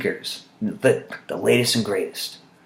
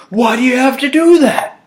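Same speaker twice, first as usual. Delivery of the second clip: high, loud whining mock boss; retold supervisor complaining about being bypassed; whiny supervisor; 7 dB louder than usual. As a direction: whine like a sulking boss. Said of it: "And he's like".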